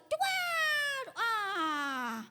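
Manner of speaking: sudden high, loud mock newborn bawl; imitated baby wail. Pinched newborn bursts out crying, imitated wail. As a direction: burst into a long baby cry that slides down. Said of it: "Langsung dia nangis".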